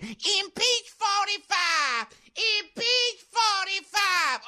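high, drawn-out mock chant; Maxine Waters. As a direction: imitate a protester's slow, high-pitched cry. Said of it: "this is the woman that keeps saying".

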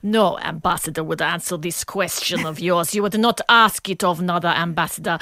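imitated Russian ambassador, scoffing and deliberate; scoffing Russian accent. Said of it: "he sort of scoffed and said".

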